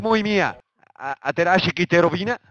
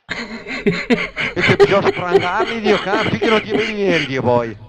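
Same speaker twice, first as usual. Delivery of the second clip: high laughing words, drawn out slowly; laughing while talking; 5 semitones higher, slower than usual. Real, not acted. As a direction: laugh through the line. Laughs through his own rant.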